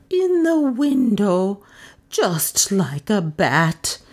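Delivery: very slow, fearful, starting high; shaking mutter. A narrator's reading. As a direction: draw it out in frightened, shaking tones. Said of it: "she muttered in shaking tones".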